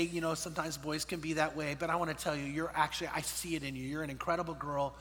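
low, soft fatherly comforting; gentle reassurance. Retold or imitated. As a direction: console her quietly, voicing a kind stepdad. Stepdad consoles crying stepdaughter, retold.

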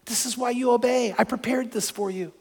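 hushed, soft inner voice of God; quiet divine reassurance, lightly voiced; God's gentle prompting. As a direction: speak very softly, as a voice sensed within. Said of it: "I could sense God saying".

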